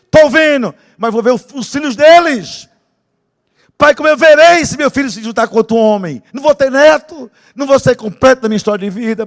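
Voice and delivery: loud, high pleading prayer; shouted prayer. Retold or imitated. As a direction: plead with God at the top of your voice.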